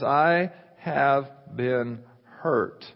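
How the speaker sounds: "I have been hurt." very slow with weight on I; slow, heavy emphasis. Shown as a demonstration. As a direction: linger on each word like a wound. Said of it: "God says".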